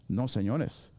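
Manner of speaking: normal talking voice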